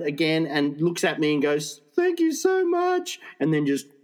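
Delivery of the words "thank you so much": high, drawn-out gushing thanks; hammed-up grateful mum voice; imitating overwrought mother. Imitates hysterical mother thanking him.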